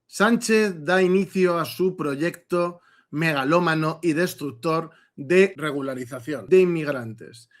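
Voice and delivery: ordinary talk, nothing marked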